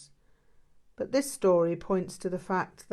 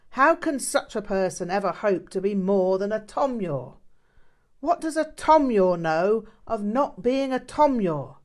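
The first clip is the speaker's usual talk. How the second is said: much louder and higher, a sharp rebuke; stern scolding outburst; 5 semitones higher, 10 dB louder than usual. Acted. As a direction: blast the student with sudden force. Teacher scolds student sharply.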